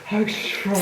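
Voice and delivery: high, badly faked British accent; mock British accent. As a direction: mimic a British lady, high-pitched. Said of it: "the way British people talk".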